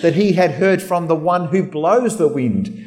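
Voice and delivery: ordinary talk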